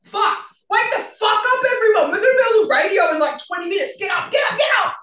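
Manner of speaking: high, loud frantic shouting to rouse everyone; panicked wake-up yell. Retold or imitated. Bandmate waking everyone for the radio interview, frantic shout. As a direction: yell the band awake, urgent and rushed. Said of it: "I'm like".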